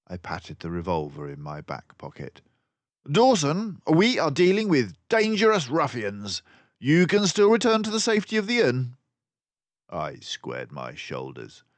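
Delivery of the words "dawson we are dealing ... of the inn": high, loud, brisk detective voice; a clever mouse sleuth, pleased with himself; Basil the mouse detective. A narrator's reading. Narrator voicing Basil to Dawson, high and loud.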